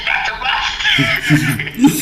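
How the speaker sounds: loud, very high drawn-out shriek; mock terror. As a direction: shriek in hammed-up fright. Naruto acted, scared of gadget.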